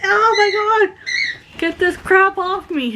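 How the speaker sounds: high squealing panic, imitating him; mock freak-out, pitch shooting up; freaked-out husband. Husband freaking out over dog vomit, squealing panic. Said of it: "Bruce freaked out and he's like".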